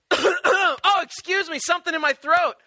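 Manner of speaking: high, loud, hammed-up innocence; mock innocent. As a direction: pretend you didn't mean to be loud. Fake throat clearing to wake Jesus.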